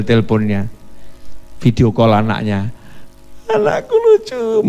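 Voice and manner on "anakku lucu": put-on much higher, cooing over a little child; a parent fawning, pitched way up; delighted doting father. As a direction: gush over your kid on a video call. Father delighted by child on video call.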